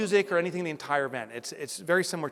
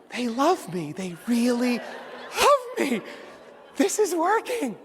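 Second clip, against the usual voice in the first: smug delight; 6 semitones higher, slower than usual. Retold or imitated. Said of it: "I'm like".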